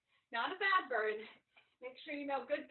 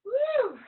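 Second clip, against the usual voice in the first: cheer; 11 semitones higher, 12 dB louder than usual. Real, not acted. Trainer cheering after set.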